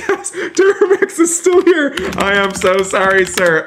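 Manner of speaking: very high, squealed alarm easing into apology; shocked apology. Real, not acted. Streamer finding the jailed player still there, shocked and sorry.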